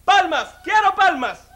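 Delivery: high, loud stage shout; bandleader calling out. Acted. Salsa bandleader demands applause from the crowd, shouting high. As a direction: call to a packed audience for clapping. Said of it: "dice el médico".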